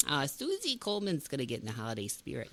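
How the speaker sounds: put-on vague made-up North Dakota or Canadian accent as Susie Coleman; made-up regional accent. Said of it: "I really don't know what accent is that".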